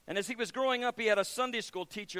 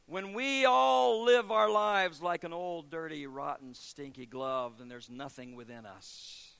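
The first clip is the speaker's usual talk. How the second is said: slow fading description; 4 semitones lower, slower than usual. Acted. Preaching descriptive build down.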